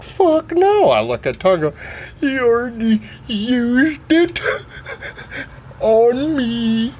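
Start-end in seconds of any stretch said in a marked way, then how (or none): 0.0-0.9 very high mock refusal; shrieked refusal. Voices Sugar Tits refusing. 2.2-6.9 high, slow, drawn-out character; put-on character voice. Voices Tonga.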